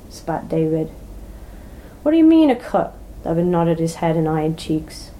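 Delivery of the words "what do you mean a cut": high, loud indignant challenge; loud, high-pitched outrage; indignant demand. Acted. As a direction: bristle and push back hard. David challenges Cheeks' demand.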